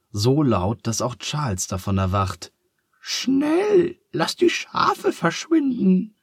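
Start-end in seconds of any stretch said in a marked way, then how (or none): 3.0-6.2 very high, alarmed squeak; startled. A narrator's reading. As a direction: squeal it urgently in a falsetto. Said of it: "sagt Charles erschrocken".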